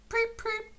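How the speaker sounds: very high piping peep; cheerful whistle. A narrator's reading. Henry greets boys on the bridge, high whistle peeps. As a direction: pipe like a train whistle. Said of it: "he whistled cheerfully".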